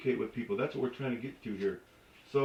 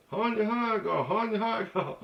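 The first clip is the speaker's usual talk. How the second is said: mimicked tipsy woman; 7 semitones higher than usual. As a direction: sing it out high and slurring. Acts out tipsy woman again.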